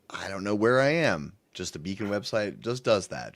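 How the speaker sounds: normal talking voice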